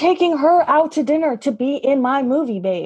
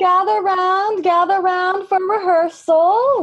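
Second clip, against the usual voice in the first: calling out; 7 semitones higher than usual. Acted. Director calling everyone together.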